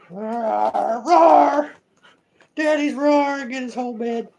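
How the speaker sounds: high, loud pretend roar; playful pet roar. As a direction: roar as a small pampered pet. Pet roars about the bed.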